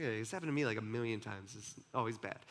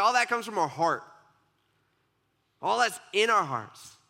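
louder, firm insistence on heart; emphatic declaration; 5 semitones higher, 5 dB louder than usual. Retold or imitated. Jesus firmly telling disciples everything comes from the heart. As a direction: drive the point home with force.

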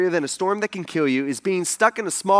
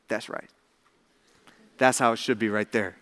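low, very soft aside; quiet approval; 10 semitones lower, 7 dB quieter than usual. Retold or imitated. Jesus approving of disciples who fear him, quiet and low. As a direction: confirm it calmly, barely raising the voice.

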